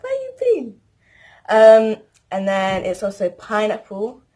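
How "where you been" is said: a high, sharp grandparent's demand; mock the grandparents calling her out, much higher; scolding grandparent. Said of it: "her grandparents would be like".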